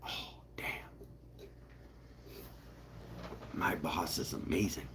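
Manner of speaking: hushed, breathless whisper of amazement; awed whisper. Retold or imitated. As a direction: whisper it like you can't believe your luck. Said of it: "you'll be like".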